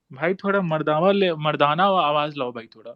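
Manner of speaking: ordinary delivery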